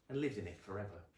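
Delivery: plain reading voice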